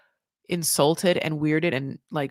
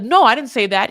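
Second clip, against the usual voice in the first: loud, higher acted denial; mock defensive protest, pitched up; indignant denial; 4 semitones higher, 8 dB louder than usual. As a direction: protest it loudly, imitating an argument. Acted denial in retold family argument.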